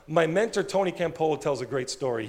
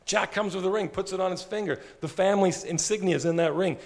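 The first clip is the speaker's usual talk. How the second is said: ordinary delivery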